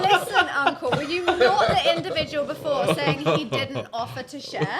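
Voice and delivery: high, loud protest over the others; loud playful challenge. Real, not acted. Guest calls out uncle over the crosstalk, loud playful challenge. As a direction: shout to be heard, half laughing.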